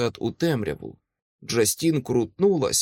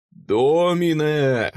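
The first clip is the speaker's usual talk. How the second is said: high, long-held battle cry; slow, sonorous cry; ringing shouted invocation; 4 semitones higher, 4 dB louder, much slower than usual. A narrator's reading. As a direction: draw the holy name out like a bugle. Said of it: "продовжував кричати Майкл".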